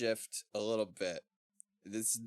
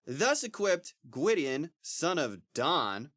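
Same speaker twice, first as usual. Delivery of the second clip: slow, raised storybook proclamation; grand reading aloud; 4 semitones higher than usual. Acted. Reading his namesake's legend to a friend, grandly. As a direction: read it out with mild grandeur. Reads from the book.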